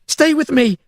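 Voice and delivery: high, loud desperate cry; shouting to keep him conscious. A narrator's reading. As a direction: yell to keep him awake. Shaking unconscious man.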